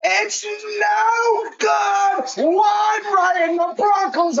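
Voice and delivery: high drawn-out yell; shouting. Real, not acted. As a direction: scream it in disbelief. Missed field goal, screaming.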